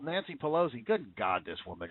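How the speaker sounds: ordinary delivery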